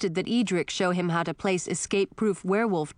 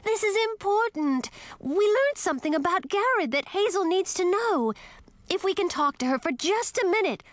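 wailing; 13 semitones higher than usual. A narrator's reading. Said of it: "Millie wailed".

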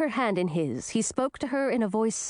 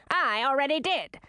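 testy queen, pitched up; higher, irritated retort; snapped; 4 semitones higher than usual. A narrator's reading. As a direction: snap back in a put-on queen voice. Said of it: "snapped Olivine".

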